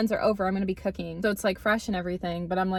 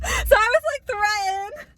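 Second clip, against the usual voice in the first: high, cracking squeal of jealousy; mock-distressed squeal; 13 semitones higher, 5 dB louder, slower than usual. Real, not acted. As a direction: squeak it out like it still stings. Recalling jealousy, voice jumps.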